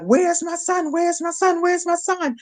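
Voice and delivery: high, desperate repeated cry for a son; panic-stricken dad calling out; husband frantically searching for his son, high and desperate; frantic irate father. Retold or imitated. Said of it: "so irate".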